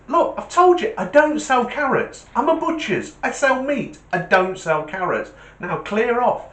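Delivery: high, loud acted annoyance; exasperated butcher. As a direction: tell off a pest at the end of your patience. Butcher angrier with rabbit.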